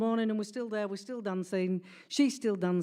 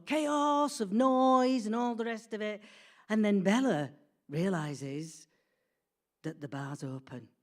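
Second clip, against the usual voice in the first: ordinary delivery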